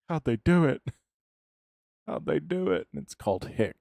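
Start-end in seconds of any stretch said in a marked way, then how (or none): 0.1-0.8 high, loud gasping question; mock gasp. Streamer teasing Ben's gasp, hammed-up high loud astonishment. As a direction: play up the shocked chatter. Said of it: "you gasped".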